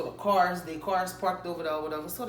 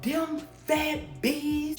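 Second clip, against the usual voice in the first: high, slow, wailing mimicry of the crying mother; mock crying mom; 9 semitones higher, much slower than usual. Imitating the crying mother pointing at the girls, high and drawn out. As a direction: imitate the upset mother sobbing out the words, pitched high. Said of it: "crying at the same time".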